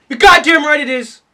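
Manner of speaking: hammed-up patriotic indignation; indignant rant. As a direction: argue back at a shout. Arguing loudly with the lyric.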